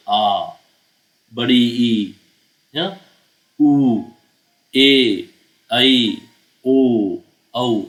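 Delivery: हर दीर्घ स्वर ज़ोर लगाकर लंबा बोलो; long vowels said with force. Shown as a demonstration. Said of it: "हम जोर लगा के बोलते हैं".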